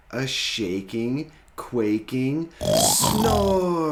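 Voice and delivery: loud, slow, drawn-out snore; booming snore. A narrator's reading. Dad reading to kids, hams up the snore. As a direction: let the snore shake the room.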